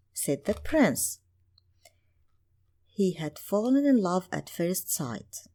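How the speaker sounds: usual speaking voice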